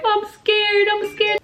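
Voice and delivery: very high-pitched mock whimper; imitated scared child. Daughter answers her mother from bed, high frightened child voice. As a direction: put on a frightened little girl's voice. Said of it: "she just starts crying".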